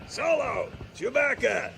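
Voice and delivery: high, hearty calling out of names; boisterous delighted welcome; delighted greeting. Acted. Old friend greeting arrivals.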